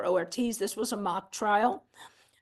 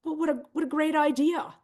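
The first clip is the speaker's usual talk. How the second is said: mock delighted dog voice; 6 semitones higher than usual. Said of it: "And Jules says".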